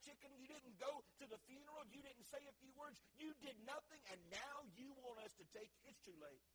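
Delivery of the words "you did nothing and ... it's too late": ordinary delivery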